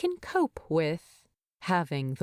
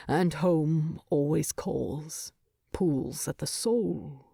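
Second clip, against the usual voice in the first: a hint of a low, resigned dwarf king; lightly voiced Thorin; 3 semitones lower than usual. Thorin turning away from Bilbo, a lightly lowered subdued voice. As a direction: lower and sadder, holding back.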